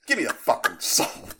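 loud, strained demand; hammed-up furious demand; mock outraged yell. Mock outrage at stolen soul.

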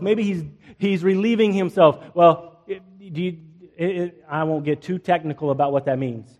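ordinary delivery

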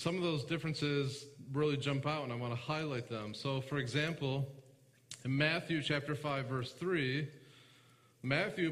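usual speaking voice

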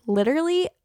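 high, sneering one-word question; mock someone who says literally. Mocking people who overuse literally, sneering question. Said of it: "people got made fun of for it, saying, like".

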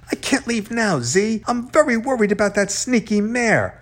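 high-pitched fretting; beside himself. A narrator's reading. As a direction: fret urgently, voice pitched well up. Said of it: "was practically beside himself".

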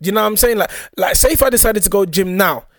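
ordinary talk

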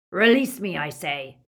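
loud burst, then dropping back; rasped demand. A narrator's reading. Trapped werewolf insists the witch free him, rasped demand. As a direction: demand release, hard on the first words. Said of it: "rasped Greyback".

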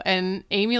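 ordinary talk

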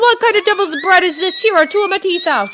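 high, loud giant's complaint; outraged shout. A narrator's reading. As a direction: shout through the toothache. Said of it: "shouted Cuchulainn".

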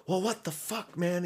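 high-pitched exasperated protest; imitated arguing. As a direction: mimic a friend squawking in an argument. Retold argument between D and Trey.

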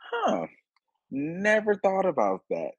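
high "huh", sudden realization; surprised realization. Retold or imitated. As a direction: sound struck by a new idea. Her surprised realization quoted.

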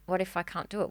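usual speaking voice

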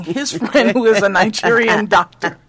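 amused, laughter breaking into the words; laughing. Real, not acted. Storyteller reveals a friend's odd marriage offer, laughing. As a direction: laugh through the absurd offer.

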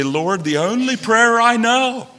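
slow, high cry to the Lord; desperate prayer. Acted. Acted desperate prayer to God.

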